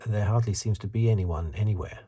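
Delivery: normal talking voice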